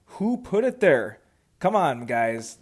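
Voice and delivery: slow, loud playful accusation; mock indignation. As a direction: accuse the room in drawn-out mock outrage. Mock accusing others of moving mallet.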